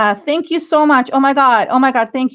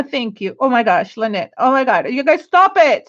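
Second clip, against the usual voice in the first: ordinary delivery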